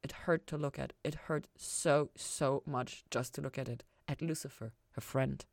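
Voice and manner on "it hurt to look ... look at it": ordinary delivery, nothing marked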